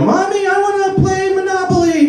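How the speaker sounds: put-on very high child's whine; little kid. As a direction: beg like a small boy. Child voice again.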